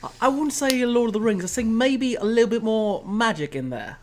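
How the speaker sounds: light put-on British accent. Guest teasing the host in a British accent. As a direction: chat along in a casual British accent. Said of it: "I'll break the accent now".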